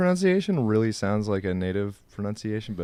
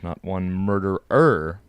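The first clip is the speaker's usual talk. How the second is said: "murderer" drawn out slowly, final "-er" set apart; stress on murderer's ending; 4 semitones lower, slower than usual. Real, not acted. Co-host correcting one murder to one murderer, slow stress on "murderer". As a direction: stretch "murderer" so the extra "-er" lands clearly.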